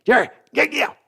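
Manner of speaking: high, far-off mock voice of the old Cowboys owner; cranking up a squeaky Jerry voice; Jerry Jones impression. Chat requested the Jerry impression, mock Jerry voice. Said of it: "your on point Jerry and Sons impression".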